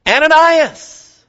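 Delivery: slow, high, ringing call of a name; loud, slow divine summons; the Lord calling. Acted. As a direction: call his name like a voice from heaven, drawn out. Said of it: "To him the Lord said in a vision".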